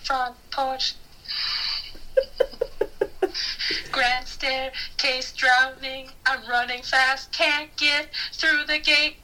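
slow dramatic narration. Pretend. Acting out drowning on Titanic.

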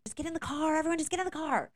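high, loud impatient yell. Retold or imitated. Mother to her kids at the car, impatient yell. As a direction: herd everyone into the car.

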